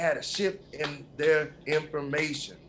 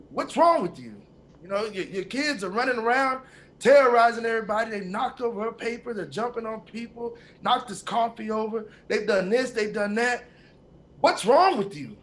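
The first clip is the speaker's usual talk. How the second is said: higher, louder exasperated scolding; angry snap; 7 semitones higher, 5 dB louder than usual. Retold or imitated. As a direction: tell him off, fed up. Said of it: "The guy kinda snaps too".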